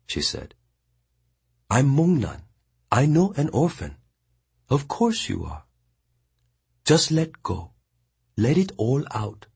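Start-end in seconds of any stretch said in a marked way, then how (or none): 1.7-9.5 higher, steady woman's voice; Mongnan consoling. A narrator's reading. Mongnan firmly consoling the weeping boy. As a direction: tell him firmly he died well.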